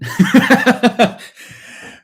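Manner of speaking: real burst of laughter; high, loud laugh at oneself. Real, not acted. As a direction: laugh out loud at your own silly impression. Laughing at own bad accent.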